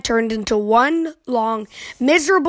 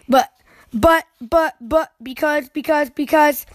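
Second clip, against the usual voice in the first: bickering cubs; 3 semitones higher than usual. Acted. Cubs' bickering buts and becauses.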